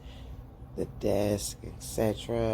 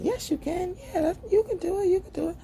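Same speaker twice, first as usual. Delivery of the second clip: much higher, eager cheerleader voice; squeaky hyped-up friend cheering her on; high cheering encouragement; faster than usual. Retold or imitated. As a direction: pump her up in a high excited voice. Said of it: "Nina's like".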